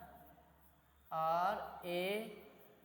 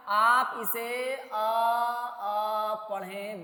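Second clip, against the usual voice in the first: slow drawn-out demo; much slower than usual. Shown as a demonstration. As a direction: stretch each आ very slowly, one after the other. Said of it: "तो आप क्या कीजिएगा". (बहुत धीरे खींचकर पढ़ना)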